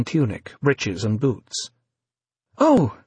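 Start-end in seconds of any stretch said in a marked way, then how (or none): 2.6-3.0 sudden high startled yelp; a sharp high yelp of fright. A narrator's reading. Evie, startled by V emerging, yelps. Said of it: "yelped Evie, startled".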